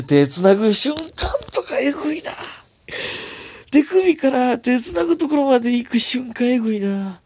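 high, strained gushing, drawn out; excited swooning. Real, not acted. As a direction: squeal over the hand-holding moment. Swooning over hand-holding moment. (悶絶するような興奮)

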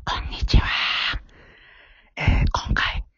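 hushed whisper so nobody hears. Real, not acted. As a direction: secret whisper before a prank. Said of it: "ちょっと小声で話していますのは".

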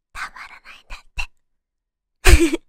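breathy unvoiced whisper. Real, not acted. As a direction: lean in and whisper it. ささやきで言う. (ささやき声で)

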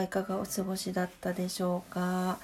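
ordinary talk, nothing marked

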